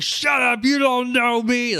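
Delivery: put-on high, loud drunken shout; drunk yelling at ghost. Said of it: "very good".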